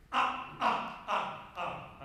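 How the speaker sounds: forceful short "ah" bursts, easing off; energy releasing. Shown as a demonstration. Conductor shows energy releasing on the beat, punchy ahs. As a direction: let each ah out as a burst of release. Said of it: "with the energy then releasing".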